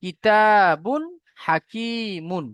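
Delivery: কিতাবুন হাকিমুন drawn out very slowly, tanween said clearly before হা; teacher shows izhar in কিতাবুন হাকিমুন; slow model reading. Shown as a demonstration. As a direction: stretch কিতাবুন হাকিমুন syllable by syllable. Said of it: "তাহলে".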